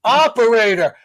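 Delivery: high loud call, laughing at the end; frustrated mock yell at the phone tree; shouting at the phone. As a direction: yell it at the robot menu. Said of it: "it just gets louder".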